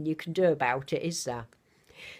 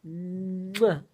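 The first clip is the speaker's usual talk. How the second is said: air kiss. Real, not acted. Grandmother blowing a kiss to Ginny. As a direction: blow a kiss down the phone. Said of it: "a big kiss to everybody".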